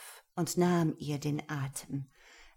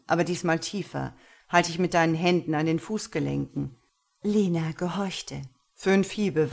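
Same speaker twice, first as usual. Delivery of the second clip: dominant master; 5 dB louder than usual. Acted. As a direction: speak as a pleased, cold master. Master gives orders. (Herr spricht zufrieden und dominant zu Lena)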